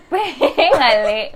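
a slow, loud urging order to a friend; urging command. Retold or imitated. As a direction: stretch it out slowly, pushing her to act. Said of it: "alguien me dijo, como que".

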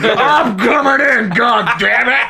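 high, slow shouting tree. Pretend. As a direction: yell it as a goofy tree.

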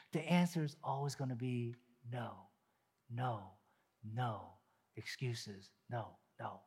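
trailing quiet refusal; fading no. Acted. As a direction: let the nos fade away. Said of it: "navel gazing".

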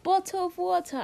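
showing off a British-sounding accent; proudly demonstrated put-on accent. Shown as a demonstration. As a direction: bottle of water in a playful British-style accent. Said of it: "I'm good at an Aust".

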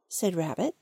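ordinary delivery

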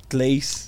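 tleis said with tl for tr; lisp. Shown as a demonstration. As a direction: say tleis, tl in place of tr, as a child would. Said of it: "sempre falei o meu tleis errado".

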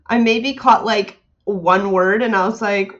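normal talking voice

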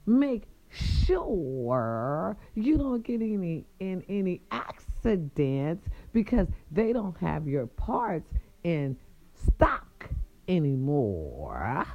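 slow, drawn-out warning; emphatic repeat. Real, not acted. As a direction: stretch out the key words deliberately. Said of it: "One more time".

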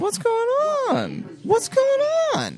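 high slow puzzled voice. Pretend. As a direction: float the question dreamily. Mock puzzled boys drawn.

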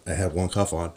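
ordinary talk

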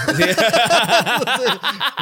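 bursting into loud laughter; big laugh, cracking up. Real, not acted. Laughing at a burp joke.

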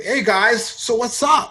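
putting on a cool gringo act; fake casual American. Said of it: "yo me acercaba a la gente". (saludo gringo relajado algo exagerado)

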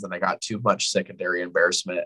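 ordinary talk, nothing marked

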